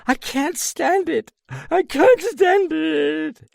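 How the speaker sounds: very high wailing moan; woman breaking down over a death. A narrator's reading. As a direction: moan in despair. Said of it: "a woman moaning".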